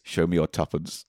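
low, soft quoted line; quoted sketch line. Retold or imitated. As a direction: mumble it like the sketch. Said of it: "there's a whole thing where it's like".

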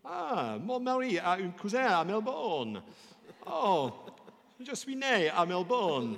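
high, loud, effusive French; loud, sing-out delight at a shared connection; delighted French exclamation. Acted. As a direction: gush in French, pitch soaring. Delighted French reply in the story.

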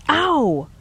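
high sharp yelp of pain; short high ow. A narrator's reading. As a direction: yelp as nails dig in. Man's hand gets clawed.

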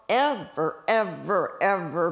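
each "ever" punched loud; emphatic repetition. Real, not acted. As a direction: hammer every "ever" separately. Stressing how strict the ban was.

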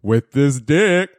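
drawn-out, slow punchline; slow dramatic reveal. Retold or imitated. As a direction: stretch it out for effect. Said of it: "got on stand and said".

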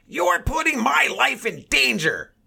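high, loud, slow accusing yell; mock hysterical shout. Older shopper accusing a maskless man, mock hysterical shout. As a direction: imitate a man in outraged alarm.

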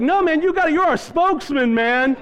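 high, urgent rallying voice; imitated insistent passengers. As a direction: push him forward as your champion. Acted passengers appointing him their spokesman.

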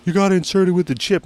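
high, put-on cashier voice; mock cashier. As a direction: lecture him like a fussy cashier.

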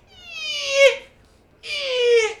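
very high, loud frightened squeak; high scared squeal. Retold or imitated. Scared on the creaking Eiffel Tower stairs, high frightened squeal.

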